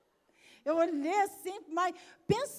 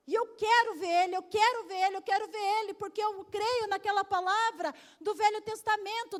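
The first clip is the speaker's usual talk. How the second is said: fervent preaching; 4 semitones higher, 5 dB louder than usual. Real, not acted. Preacher building to a cry.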